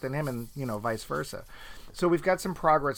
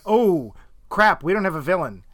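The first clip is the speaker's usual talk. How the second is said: panicked writers; 6 dB louder than usual. Retold or imitated. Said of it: "I swear they all went".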